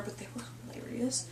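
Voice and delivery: whispered, breathy aside. Real, not acted. Whispering to a cohost that they were hilarious. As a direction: drop to a whisper for the punchline.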